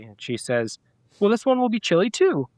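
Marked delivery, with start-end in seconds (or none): 1.2-2.5 put-on high, bright child's voice, a touch louder; playfully voicing the kid's answer; imitating his daughter. Dad imitates daughter naming the new puppy, high mock kid voice.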